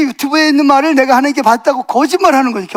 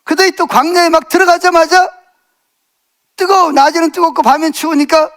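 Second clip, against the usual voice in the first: raised, urgent sermon delivery, pushing the congregation with questions; full-force preaching; 4 semitones higher than usual. Acted. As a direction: preach it hard, calling out to the pews.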